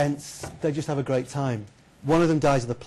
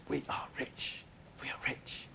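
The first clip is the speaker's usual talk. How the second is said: hushed, whispered awe; awed whisper; 16 dB quieter than usual. Acted. Friends finding a huge pile of gold, awed whisper. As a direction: whisper it in greedy wonder.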